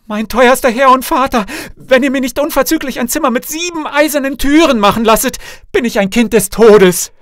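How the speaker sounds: high, loud, frantic plea; desperate cry. A narrator's reading. Frightened princess rushing to her father for protection, loud desperate cry. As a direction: cry out in high-pitched urgency. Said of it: "rief aus".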